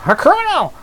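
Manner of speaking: shrill repeated outburst; high outraged cry. Acted.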